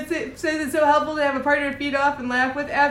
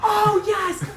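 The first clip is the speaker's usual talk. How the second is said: excited; 9 semitones higher, much slower than usual. Real, not acted. Eager agreement.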